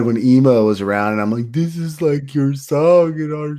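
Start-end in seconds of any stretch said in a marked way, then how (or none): 1.5-3.6 higher, drawn-out mock lovesick plea. Retelling emo-era dedications to friends, mock lovesick. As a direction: imitate a gushing emo romantic.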